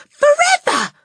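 put-on high, loud childlike wail; anguished cry. A narrator's reading. Matilda telling teacher it is for good, a high anguished cry. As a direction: cry it out in despair. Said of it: "Matilda cried".

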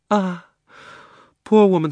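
high, loud sighing pity; mock pity. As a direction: sigh with put-on sympathy. Uncle sentimental.

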